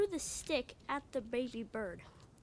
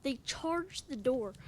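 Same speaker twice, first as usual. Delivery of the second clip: ordinary delivery, nothing marked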